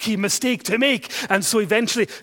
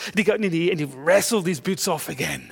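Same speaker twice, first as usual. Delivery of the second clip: usual speaking voice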